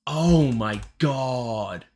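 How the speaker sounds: loud, very slow, stretched-out exclamation; overwhelmed awe. Real, not acted. Reactor raving about Ellie's grit, loud drawn-out awe. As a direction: savour each word, loudly amazed.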